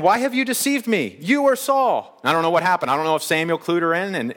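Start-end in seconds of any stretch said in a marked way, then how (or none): 0.0-2.2 high-pitched dramatic accusation, lightly acted; mock-alarmed woman confronting a deceiver; mildly freaking out. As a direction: cry it out high, drawing out the end. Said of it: "she's freaking out".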